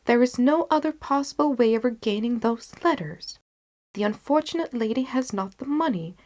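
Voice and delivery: Holmes determined. Acted. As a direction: relish the sporting duel. Holmes voiced in story.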